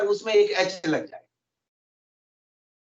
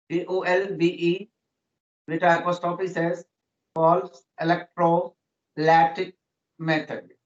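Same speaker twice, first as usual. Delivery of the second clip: slow spelling out; 2 semitones lower, much slower than usual. Shown as a demonstration. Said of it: "हम लोग कहते हैं calls".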